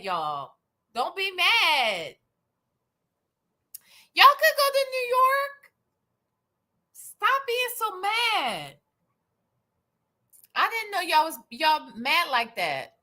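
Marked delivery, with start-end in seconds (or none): 0.9-8.8 mock pleading. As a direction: coax them with exaggerated high-pitched sympathy. Mock pleading with angry viewers.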